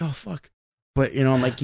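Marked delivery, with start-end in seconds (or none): 0.0-0.5 quiet oh fuck. Retold or imitated.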